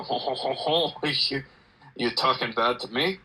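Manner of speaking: badly faked French accent; mock French with a chuckle; terrible French accent. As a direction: do a hammed-up Frenchman laughing, then challenging. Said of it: "do a terrible French accent".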